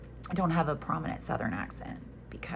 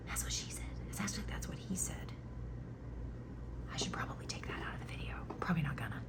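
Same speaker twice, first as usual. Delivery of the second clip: cheeky unvoiced whisper; whispered aside; 3 semitones lower, 13 dB quieter, much faster than usual. Real, not acted. Crocheter slips a joke to viewers under her breath, whispered.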